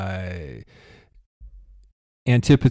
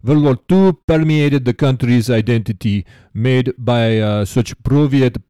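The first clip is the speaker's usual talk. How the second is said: hammed-up Russian accent, imitating a letter writer; American's fake Russian accent. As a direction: read it out in a put-on Russian accent. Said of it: "like an American trying to do a Russian accent".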